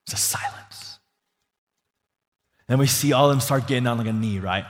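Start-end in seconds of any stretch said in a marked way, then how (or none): 0.1-1.0 hushed whisper to show the silence. Shown as a demonstration. Preacher hushing the room, in a whisper. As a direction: breathe it out, barely voiced. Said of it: "all the Narnians get dead quiet".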